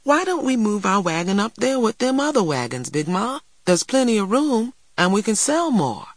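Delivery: put-on high-pitched little girl's voice; child persuading. A narrator's reading. Girl urges grandmother to move wagon, persuading.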